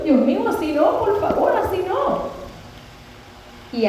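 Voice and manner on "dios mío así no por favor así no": high, pleading words breaking into a retold sob; crying plea. Patient in pain begs God to stop, crying plea. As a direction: beg God through tears, pitch climbing. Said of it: "seguía llorando".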